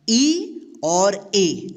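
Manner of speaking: ई and ए drawn out very slowly; two E sounds. Shown as a demonstration. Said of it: "दो sound निकलता है". (ई और ए बहुत धीरे बोलें)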